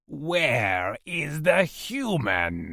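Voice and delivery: menacing alien, drawn out; threatening alien interrogator. A narrator's reading. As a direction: stretch every word of the demand. Alien interrogator repeats his demand.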